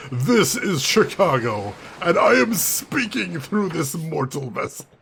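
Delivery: loud, slow, mock-possessed proclamation; spirit of Chicago. City spirit addressing listeners through the streamer, loud mock-grand voice. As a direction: intone it as a city spirit taking over a body. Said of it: "The spirit of the city takes me over".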